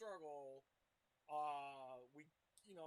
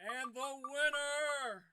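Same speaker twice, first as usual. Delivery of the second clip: hyped winner reveal; 7 semitones higher, 12 dB louder, much slower than usual. Real, not acted. Announcing the winner.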